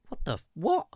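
high, stunned what; can't believe what he heard; incredulous. Real, not acted. Disbelief at the villa excuse.

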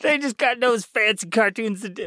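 put-on high-pitched accent slipping off course; wrong accent for Mario. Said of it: "I'm going into the wrong accent".

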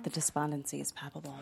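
dry muttered aside. Acted.

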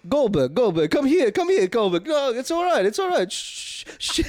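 a hint of a calming head chef, hushing; soothing. Head chef calming the raging cook, mild soothing hush. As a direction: settle him down gently, ending in a hush. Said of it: "he's like".

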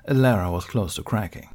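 usual speaking voice

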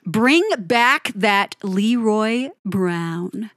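slow, spaced-out announcement of the title; grand title reveal. Acted. A music vlogger unveiling the song title slowly. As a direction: savour each word like a reveal. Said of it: "drum roll please".

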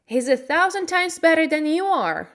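high, loud indignant defence; angry retort. A narrator's reading. Mary arguing with Colin, angry retort. Quarrel between two children.

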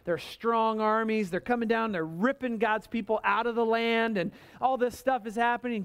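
ordinary talk

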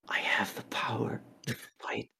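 hushed, fully whispered realization; awed whisper. Retold or imitated. Heroine looking at her hand after beating bugs, awed whisper. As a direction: whisper it like a private revelation.

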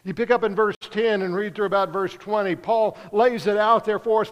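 ordinary talk

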